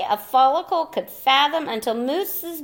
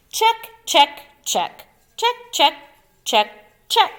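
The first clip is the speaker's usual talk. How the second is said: higher, brighter chant of check check; Moose's cheerful checklist; 6 semitones higher, 4 dB louder than usual. Acted. Moose ticks off decorations in a high sing-song chant. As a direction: tick off each item in a sing-song. Moose ticking off list in character.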